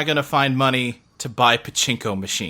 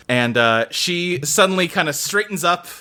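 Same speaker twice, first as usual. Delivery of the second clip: ordinary delivery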